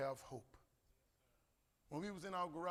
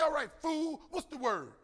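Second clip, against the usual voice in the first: a hint of an impatient wife, louder and higher; exasperated wife; 10 semitones higher, 9 dB louder, slower than usual. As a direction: snap back like a fed-up wife.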